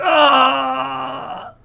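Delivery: sudden high shout of pain; pained cry. Acted. GM voicing a bandit shot in the shoulder, pained cry. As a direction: yelp like an arrow just hit the shoulder. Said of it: "and cries out in pain".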